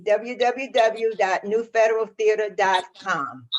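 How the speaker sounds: ordinary talk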